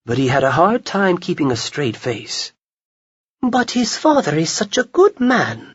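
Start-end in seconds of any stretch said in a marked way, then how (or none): 3.4-5.7 high, pleading protest; higher woman's voice, disapproving; Lily protesting. A narrator's reading. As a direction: stand up for the good father. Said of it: "Lily protested".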